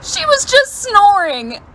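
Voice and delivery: high, slow, laughing through the words; real laughter on the punchline. Real, not acted.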